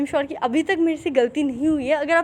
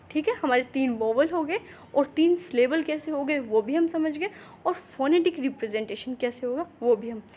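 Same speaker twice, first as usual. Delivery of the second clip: ordinary talk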